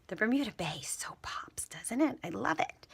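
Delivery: soft admiring whisper. Real, not acted. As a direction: whisper it to yourself. Admiring the stamp while working.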